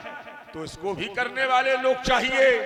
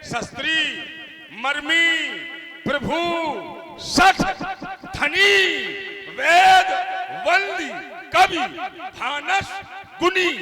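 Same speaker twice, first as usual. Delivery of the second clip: loud, high single-word calls; emphatic listing; 6 semitones higher, 5 dB louder than usual. Acted. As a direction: ring out each item separately. Counting off the nine on stage.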